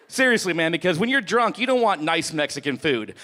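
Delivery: ordinary delivery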